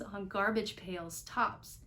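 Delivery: ordinary delivery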